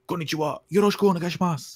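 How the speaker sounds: high, fast, hammed-up Japanese game shout; playful mock yell in Japanese. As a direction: belt out the greeting like a video game character. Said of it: "people just yell out".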